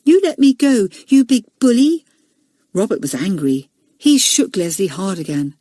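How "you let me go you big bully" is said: put-on higher and louder, a small boy shouting back; defiant shout. Leslie to Robert, defiant high shout. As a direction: stand up to him with a loud high cry.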